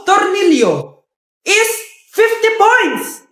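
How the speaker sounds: slow, drawn-out score call; game show host. Acted. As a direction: read the points like a quiz host.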